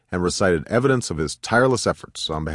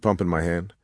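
ordinary delivery, nothing marked

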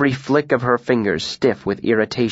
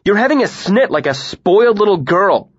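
loud, higher scornful insult; raised, mocking jab; angry taunt; 9 semitones higher, 4 dB louder than usual. A narrator's reading. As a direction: belittle her in anger. Heated quarrel insult.

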